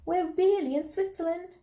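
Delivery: put-on high-pitched thrilled child; Anna. A narrator's reading. Girl realising they have reached Switzerland, high and thrilled. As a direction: let it lift right up in delight. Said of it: "said Anna".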